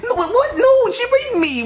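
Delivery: high-pitched, incredulous objection; indignant protest. Acted. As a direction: protest at not being believed. Protesting at being disbelieved.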